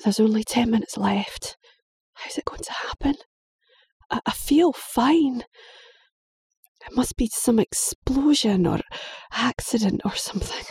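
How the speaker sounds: put-on hushed, trembling boy; boy dreading his last minutes; frightened whisper. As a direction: whisper it in fear. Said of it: "He whispers".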